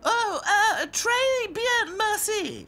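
high nervous squeak; frightened doll voice. A narrator's reading. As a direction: stammer it out in fright. Frightened princess dolls.

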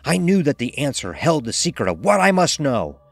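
plain reading voice

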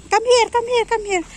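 calling her pets over, high coaxing call. Real, not acted. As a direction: coax the pets back to her.

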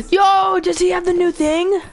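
high, loud burst of surprise; excited. Real, not acted. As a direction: blurt it out thrilled. Sudden excited discovery.

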